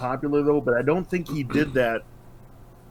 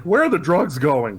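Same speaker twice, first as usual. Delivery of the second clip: lightly voiced mock Batman, only a bit louder; a half-hearted first try at sounding tough; first intimidating voice; 2 semitones higher, 5 dB louder than usual. Batman questions the thug on the roof, lightly mock-tough. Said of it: "I'm doing my intimidating voice".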